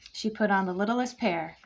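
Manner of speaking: usual speaking voice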